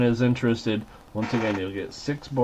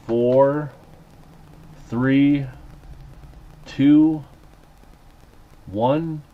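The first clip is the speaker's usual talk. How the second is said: building countdown; 2 semitones higher, much slower than usual. Real, not acted. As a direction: count down to close, rising. Countdown closing the auction.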